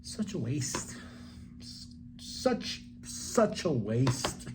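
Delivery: muttered disappointment. Retold or imitated. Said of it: "I was like".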